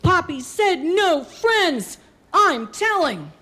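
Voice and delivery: put-on high, slow, defiant child; small kid tattling bravely; biggest, bravest little-girl voice. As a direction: stand up to the big brothers. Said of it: "in my biggest, bravest voice".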